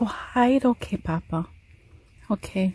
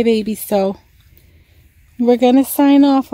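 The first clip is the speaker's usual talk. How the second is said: normal talking voice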